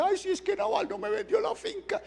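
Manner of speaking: put-on very high, whining, childish voice; hammed-up crybaby king; whiny king. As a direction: whimper like a sulking child.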